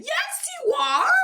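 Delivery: high, squealed mock-angry comeback; play-acted squeal of outrage; mad retort. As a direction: fire back in a very high cartoon voice. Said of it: "Peppa got mad and mad".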